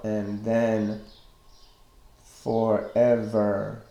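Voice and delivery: slow, measured verse with each beat drawn out; scanning the meter. Shown as a demonstration. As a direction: recite it foot by foot, pausing between beats. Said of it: "you can see that the stressed syllables".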